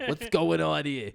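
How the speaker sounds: burped, slow Jersey guy voice. Pretend. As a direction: burp out a Jersey tough guy. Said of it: "we just make him, like, Jersey".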